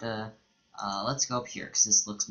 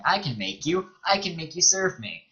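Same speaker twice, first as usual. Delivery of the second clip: arrogant ruler voice; 6 semitones higher than usual. Retold or imitated. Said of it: "the shaper shaper is basically the one's like".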